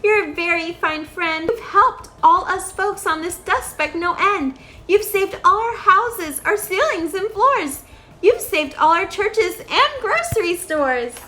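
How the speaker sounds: high, small grateful mayor voice; tiny Who mayor. A narrator's reading. The mayor of Whoville thanks Horton, high tiny voice. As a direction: pitch up small and eager like a tiny townsman.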